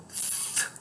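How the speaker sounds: "th" as a long voiceless stream of air; isolated TH sound. Shown as a demonstration. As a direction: blow "th" with tongue between the teeth. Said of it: "A língua vai entre os dentes".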